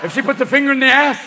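high, loud comic commander; conspiring sperm character. Acted. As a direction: give the order, then build to a shout. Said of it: "two other sperms in my balls going".

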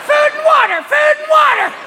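very high, loud squawking parrot. Retold or imitated. Parrot warning the family of the big one, squawking. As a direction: screech it like a frantic parrot. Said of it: "your parrot starts going".

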